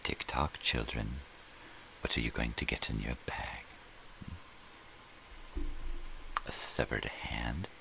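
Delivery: lightly voiced sinister Santa teasing children; Anthony Hopkins as Santa. Tease kids as a creepy Santa, in a light, sinister voice. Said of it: "He could do Santa Claus".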